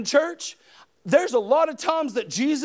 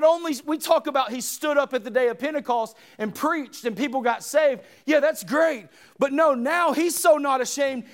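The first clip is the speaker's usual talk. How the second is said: full-force preaching; 4 semitones higher than usual. Acted. Preacher shouting to the congregation about the cross. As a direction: preach it out to the whole room.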